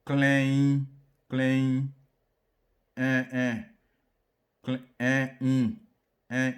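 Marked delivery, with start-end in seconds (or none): 0.0-1.9 "Klein" said loud and high, each sound apart. Shown as a demonstration. Teacher sounding out "klein" for the class, loud and high. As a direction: spell out "klein" slowly and loudly.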